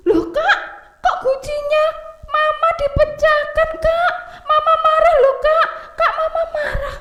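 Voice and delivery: put-on tattle like a small boy; scolding little brother. Oci warns his brother that mama will be angry, high sing-song.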